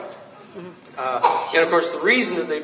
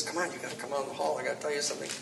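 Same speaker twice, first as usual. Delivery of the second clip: quick, very soft undertone; hushed aside; 13 dB quieter, much faster than usual. Retold or imitated. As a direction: lean in and quietly urge them out, hurriedly. Colleague leans over in court.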